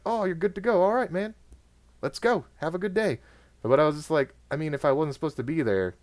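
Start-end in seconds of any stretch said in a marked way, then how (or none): 0.1-3.2 high, cheery send-off; chipper British guard. Retold or imitated. Guard lets him through the gate, chipper British accent. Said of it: "he's like".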